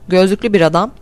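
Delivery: plain reading voice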